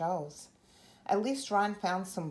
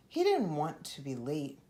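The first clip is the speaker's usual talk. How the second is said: ordinary delivery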